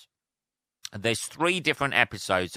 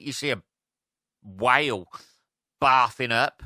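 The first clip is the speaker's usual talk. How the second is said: ordinary talk, nothing marked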